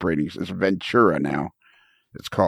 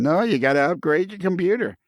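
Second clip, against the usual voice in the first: mock nagging voice, pitched high; high, whiny insistence in mockery; high-pitched whine; 9 semitones higher than usual. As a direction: imitate a whiny friend insisting. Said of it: "that high-pitched whine of his".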